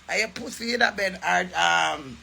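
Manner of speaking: ordinary talk, nothing marked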